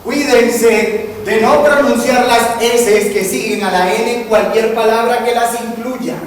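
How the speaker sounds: emphatic warning. Real, not acted. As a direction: warn them loudly, drawing out the words. Emphatic public warning about pronunciation.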